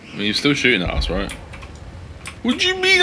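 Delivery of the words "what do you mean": surprised. Real, not acted. Confused reaction in game.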